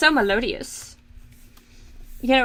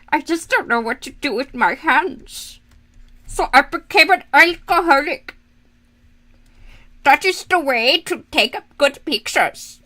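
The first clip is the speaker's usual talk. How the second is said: mock vodka-advert lady with European accent; weird annoying accent. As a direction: imitate an ad lady's hammy German-like accent. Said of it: "that weird, annoying accent that the lady from the neutral vodka commercials does".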